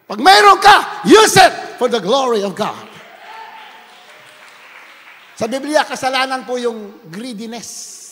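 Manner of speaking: preaching at full force. Acted. Preacher warning church against greed, full-force preaching. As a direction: drive the scripture home to the crowd. Preacher exhorting about money.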